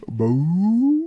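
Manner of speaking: loud, high, rising whale moan; long drawn-out "boo" like whale song; playful put-on whale cry; whale call. Whale calls after the whale joke.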